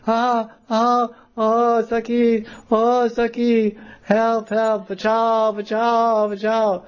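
put-on high, drawn-out girl's cry for help; dangling and calling the friends; high, slow, mock-panicked help calls; frightened cry for help. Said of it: "she's yelling like that".

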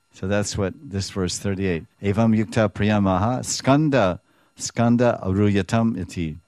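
ordinary talk, nothing marked

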